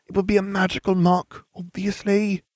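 high-pitched pouty retort; pouting. A narrator's reading. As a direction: answer back with a pout. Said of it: "Hermione pouted".

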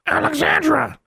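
very high squawked name; parrot-like shriek of a name; loud raven call. Acted. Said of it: "Charlie repeated".

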